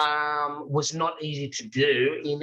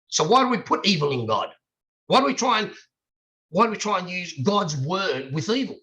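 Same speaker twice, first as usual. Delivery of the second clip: passionate; 5 semitones higher than usual. Real, not acted. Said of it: "I'm very passionate about that".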